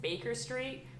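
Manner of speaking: put-on American accent with a hard R in "Baker". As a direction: try it in an American accent, questioning. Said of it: "put an American accent on".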